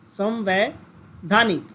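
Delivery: "संवैधानिक" drawn out syllable by syllable, very slow; slow clear repetition. Shown as a demonstration. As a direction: spell it out with the voice. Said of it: "एक शब्द होता है संवैधानिक". ("संवैधानिक" को बहुत धीरे, खींचकर बोलना)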